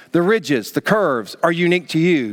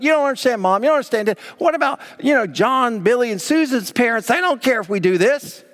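higher-pitched, pleading excuse; lightly voiced child deflecting blame; protesting child; 7 semitones higher than usual. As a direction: imitate a kid arguing with mom. Acted child protesting to mom.

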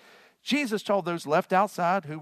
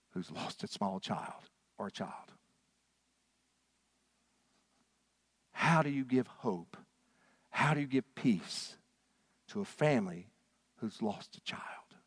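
slow, much softer, fading into a whisper; hushed grief; 5 semitones lower, 6 dB quieter than usual. Real, not acted. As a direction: let the grief pull the voice down to a whisper. Pastor recalls grieving parents.

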